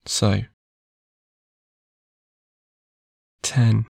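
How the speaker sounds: long pauses between soft numbers; slow soothing countdown. Acted. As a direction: count them down into sleep. Counting down into sleep.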